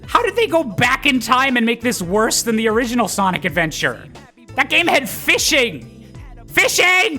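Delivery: high, loud outraged complaint; exasperated rant. Acted. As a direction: build to a disbelieving outburst. Exasperated rant at the game's design.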